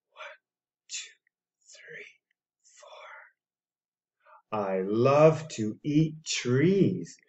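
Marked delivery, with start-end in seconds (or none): 0.1-3.3 whispered count, barely voiced. Shown as a demonstration. Parent counting dinosaur legs to a child in a whisper. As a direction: whisper the numbers like a secret. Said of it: "counting legs".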